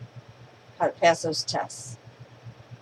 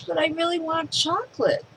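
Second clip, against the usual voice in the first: put-on whiny kid; 9 semitones higher than usual. Kid insists again, higher.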